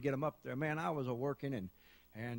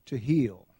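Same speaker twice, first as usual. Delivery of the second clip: quoting a preacher; 4 semitones lower, 5 dB louder, much slower than usual. Retold or imitated. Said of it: "Brother Spears was up here saying".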